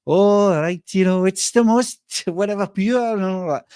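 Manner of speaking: high, lilting mock Welsh accent; bad Welsh impression that slips towards Pakistani; attempted Welsh accent. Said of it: "I'm Welsh. I mean, that's my background".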